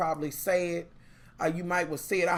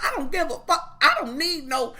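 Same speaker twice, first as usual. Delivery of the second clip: higher, sassy defiance; feisty; 7 semitones higher than usual. Retold or imitated. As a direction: toss it off like a feisty little sister. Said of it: "she's good and feisty".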